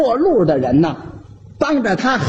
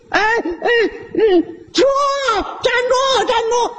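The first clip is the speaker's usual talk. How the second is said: frantic calling; 14 semitones higher than usual. Acted. The chubby uncle runs after his bike yelling at the thief to stop. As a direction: shout after the thief while running.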